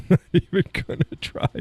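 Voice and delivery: high, breaking up with laughter; helpless laughing refusal. Real, not acted. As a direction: squeeze it out while cracking up. Cracks up repeating the refusal.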